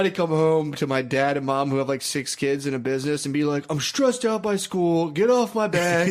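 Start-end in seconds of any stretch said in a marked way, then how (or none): 3.7-6.1 mock teen whining. Acting out whiny drunk teenager.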